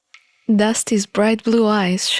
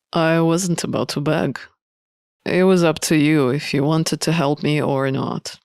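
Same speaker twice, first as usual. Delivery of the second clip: lower, cool prisoner who won't beg; the prisoner answering the hero coolly, in a lower voice; lower proud prisoner; 5 semitones lower than usual. Acted. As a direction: say it low and detached, too proud to ask.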